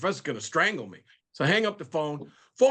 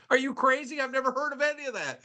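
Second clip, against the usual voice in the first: incredulous; 8 semitones higher than usual. Retold or imitated. Roland hearing the long list.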